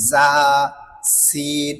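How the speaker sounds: জা and সিন each held long; demonstrating articulation. Shown as a demonstration. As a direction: stretch buzzing জা, then hissed সিন, pause between. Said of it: "আগার সঙ্গে লাগাইয়া".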